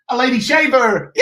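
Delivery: loud, high game-show cheer; mock prize announcement. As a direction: announce a silly prize with a big hammed-up cheer.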